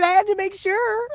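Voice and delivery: high mimicking voice; imitating the other woman. Streamer repeating the other speaker's remark, high-pitched imitation. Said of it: "She said".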